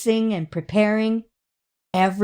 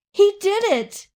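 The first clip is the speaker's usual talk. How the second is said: much higher, louder triumphant cry; joyful cheer; 11 semitones higher, 3 dB louder than usual. A narrator's reading. Narrator cheering the chick's flight, high and loud. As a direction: shout it out in delight. Paper plane takes off.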